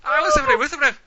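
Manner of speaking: very high, loud little gnome voice; tiny gnome snitching on the player; squeaky gnome. Acted. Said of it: "they go".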